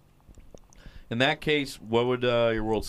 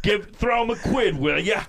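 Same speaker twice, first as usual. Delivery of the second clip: high, exaggerated British street voice; mock British accent; 9 semitones higher, 3 dB louder than usual. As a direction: ham up a high-pitched Cockney plea. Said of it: "Inbred British people".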